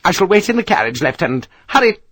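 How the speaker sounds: quick, high, clipped-off orders; impatient matron, high and hurried; imperious. Acted. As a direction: sweep out giving orders. Mrs Parker imperiously departing.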